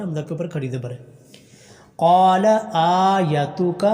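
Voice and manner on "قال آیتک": slow drawn-out tajweed chant; slow tarteel recitation; Quran recitation. Shown as a demonstration. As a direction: recite each vowel long and measured. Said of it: "حمزہ کو لمبا کرنا".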